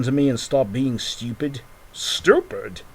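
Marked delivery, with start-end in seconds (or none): 1.9-2.8 high, breathy outraged echo of the insult; offended squeak. Pretend. Insulted toy friend repeating the word, outraged squeak. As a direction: squeak it back, deeply offended. Acted quarrel between toy characters.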